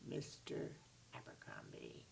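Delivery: low, slow, hushed spooky whisper; ominous whisper. A narrator's reading. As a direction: breathe the name with dread. Dramatic name reveal.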